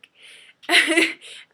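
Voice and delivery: loud self-amused laugh; burst of laughter. Real, not acted. Laughing after fumbling the host's name, loud burst. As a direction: crack up at your own attempts.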